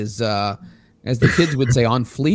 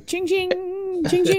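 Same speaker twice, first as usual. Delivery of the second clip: mock bell; 3 dB quieter than usual. Mocking scooter bell.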